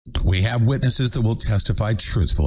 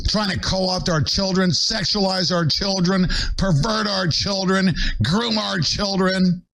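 high, intense list, each verb hammered; fired-up accusing cadence, high; heated rant; 9 semitones higher than usual. Real, not acted. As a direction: build the charge line by line, pitched way up. Rising rant about children.

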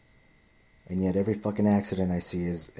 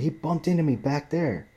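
higher, louder imitated complaint; mock screaming woman; 8 semitones higher, 4 dB louder than usual. Angry driver insisting to coworkers, mimicked higher. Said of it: "fucking screaming at me".

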